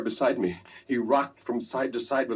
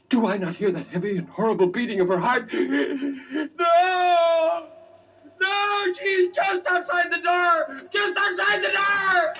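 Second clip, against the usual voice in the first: frantic scream. Acted. Roderick shrieking to his friend that Madeline is at the door, terrified. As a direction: scream it as the dead woman reaches the door. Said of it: "the superhuman energy of his utterance".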